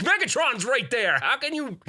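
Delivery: high, disbelieving outburst; can't believe they don't care; incredulous outburst. Retold or imitated. Dad to his bored kids, high and incredulous.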